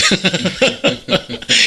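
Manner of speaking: amused burst of real laughter. Real, not acted. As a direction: laugh out loud at your own joke. Laughs at own remark.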